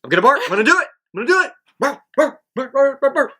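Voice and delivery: high, excited puppy voice, climbing into yips; eager dog voice. Retold or imitated. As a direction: bounce up like a dog about to bark. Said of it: "how a dog, I feel like, really would say it".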